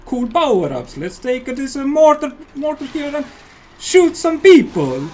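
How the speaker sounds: put-on booth host in a thick Italian accent. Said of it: "I do a really good Italian accent also".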